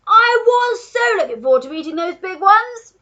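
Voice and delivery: high, loud, frustrated complaint; duke shouting. A narrator's reading. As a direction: wail loudly like a thwarted duke. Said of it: "shouted the Duke".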